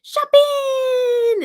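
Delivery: very high, loud, stretched-out squeal; piercing, drawn-out toddler cry; toddler's taught catchword. Retold or imitated. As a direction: imitate a little girl singing out her word. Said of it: "her mom teaches her to go".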